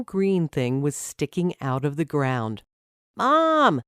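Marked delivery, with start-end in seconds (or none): 3.2-3.8 put-on boy calling out high and loud for mom; excited call. A narrator's reading. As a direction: shout for mom across the garden, thrilled. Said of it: "he called".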